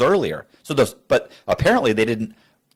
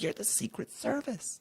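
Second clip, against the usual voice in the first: disbelieving aside; 11 semitones higher, 15 dB quieter than usual. Retold or imitated. Mocking the agents' mistake.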